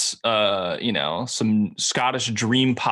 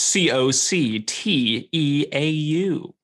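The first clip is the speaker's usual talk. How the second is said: slow, higher letter-by-letter spelling; spelled out; 4 semitones higher, much slower than usual. Shown as a demonstration. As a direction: spell it out slowly, each letter separate.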